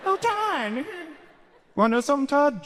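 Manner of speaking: very high eerie ghost voice calling Todd; ghostly taunt. Acted. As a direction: float the words up like a spooky ghost.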